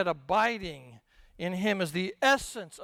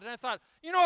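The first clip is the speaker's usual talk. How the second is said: ordinary talk, nothing marked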